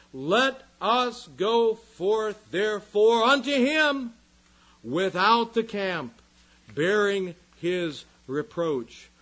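slow, loud, ringing scripture exhortation; loud, measured pulpit call; rousing proclamation. Real, not acted. Preacher proclaims the verse's exhortation.

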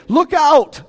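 high, loud cry of alarm; warning shout. Pretend. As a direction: yell it to warn someone. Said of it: "why golfers just don't say".

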